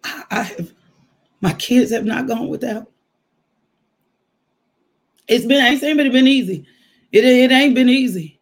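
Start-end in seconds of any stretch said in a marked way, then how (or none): 5.3-8.4 preaching it loud. Real, not acted. Preaching climax on hardship.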